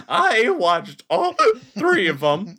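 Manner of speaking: loud, high, very drawn-out mock-drunk voice; mocking drunk imitation. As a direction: exaggerate a friend's slurry bragging, stretching every word. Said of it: "you said it like".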